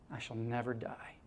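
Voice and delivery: a hushed whisper to close the poem; whispering the poem's final promise to the congregation. Acted. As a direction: breathe the last line out softly. Final line of recited poem.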